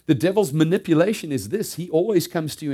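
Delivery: loud, high preaching; rising pulpit intensity. Real, not acted. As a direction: drive the warning home from the pulpit. Preacher building to full force.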